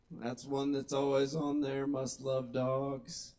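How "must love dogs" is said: slow, drawn-out repeat of the cliché; slow quoted phrase. Shown as a demonstration. Comic repeating a dating profile cliché, slow and mocking. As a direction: savour each word mockingly.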